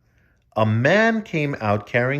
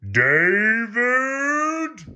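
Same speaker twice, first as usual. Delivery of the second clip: called name from another room; mock far-off father's call; 12 semitones higher than usual. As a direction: call David from a distance. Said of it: "someone called".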